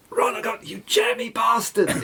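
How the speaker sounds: high, teasing jeer; mock jeer. Friend jeering at the lucky first cast, high and teasing. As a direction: rib him for his luck, gleeful.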